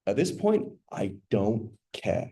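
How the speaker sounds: slow, breathy whisper of giving up; quietly repeating a husband's hopeless words in a whisper; hushed, defeated. Retold or imitated. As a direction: let it fall to a near whisper, heavy and slow. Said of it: "That was a painful, pa-, powerful, st-, painful statement".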